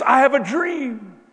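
loud slow ringing oratory; loud slow visionary proclamation; orating King. Retold or imitated. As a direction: proclaim it like a famous speech. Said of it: "Who said this?".

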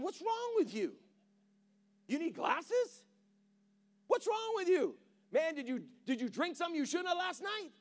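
a frantic servant who can't believe it; panicky servant. Retold or imitated. Servant yelling at the prophet in disbelief, high and panicked.

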